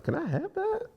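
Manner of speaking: high, soft little voice; soft squeaky plea; retold timid request. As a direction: ask small and high, half-joking. Retells own timid request.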